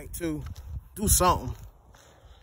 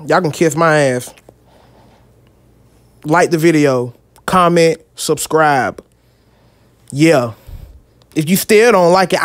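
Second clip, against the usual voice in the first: loud, slow announcer-style opening; hyped intro; 15 dB louder, slower than usual. Acted. Vlogger opening his video with a loud, slow hype intro. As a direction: hype up the viewers like a showman.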